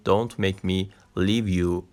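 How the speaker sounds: "Don't make me leave you." spoken very slowly and clearly; a slow model line for learners; slow pronunciation demo. Shown as a demonstration. (تلفظ آهسته و واضح)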